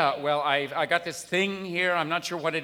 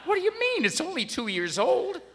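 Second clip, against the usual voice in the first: shocked disbelief; 10 semitones higher than usual. Acted. Customer stunned the clerk won't carry a two-year-old part, high disbelief. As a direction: pitch jumps up high in disbelief.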